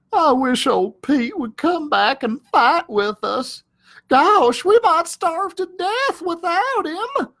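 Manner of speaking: high, sad little dog voice; droopy sidekick dog missing his rival; Drover, mopey. Acted. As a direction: sigh it out like a lonesome pup.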